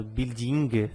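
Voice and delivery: "building" with a sounded final g; "building" ending in a hard g plus faint vowel; old-fashioned pronunciation. Retold or imitated. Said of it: "Mas antigamente eu".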